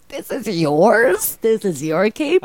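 very slow, drawn-out questions; stoned marveling, each word stretched; mock wonder. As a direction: present it slowly, as if in awe. Acting out stoned self presenting capes.

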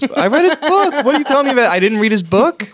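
high, loud incredulous protest; mock indignation. Friend insisting on having read the book, mock indignant. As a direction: protest in a squeaky, offended pitch.

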